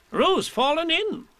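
high, slow alarmed Rabbit cry; cried out. A narrator's reading. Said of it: "cried Rabbit".

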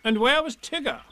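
high questioning animal voice; Rabbit. A narrator's reading. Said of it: "asked Rabbit".

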